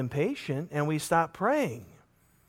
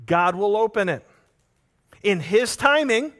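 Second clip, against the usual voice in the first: ordinary talk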